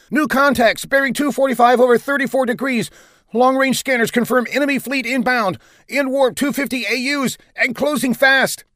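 very high, urgent call-out; frenzied. A narrator's reading. Bridge officer warning of an incoming enemy fleet, frenzied. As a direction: cry out the report in alarm. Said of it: "a frenzied voice calling out".

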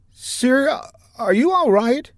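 high-voiced, jittery officer reporting; lieutenant reporting to captain, high and nervous; nervous young lieutenant. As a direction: report while still shaken.

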